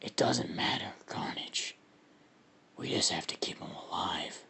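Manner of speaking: deep, soft, whispery monster voice; Venom, deep voice. Acted. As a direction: drop deep and husky, keep it quiet. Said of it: "I gotta do my deep voice for this".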